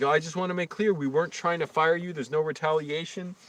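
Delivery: mock apologetic manager. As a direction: lightly mimic her fake apology. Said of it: "all apologetic now".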